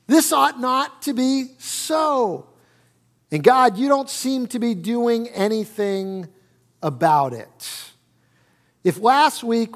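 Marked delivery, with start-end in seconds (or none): 0.1-7.8 indignant complaint. Retold or imitated. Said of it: "And he basically says".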